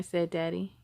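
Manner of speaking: ordinary delivery, nothing marked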